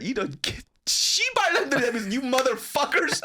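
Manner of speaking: high, loud imitation of a furious mother; mom screaming. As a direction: screech at the sons like an angry mom. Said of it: "she starts screaming".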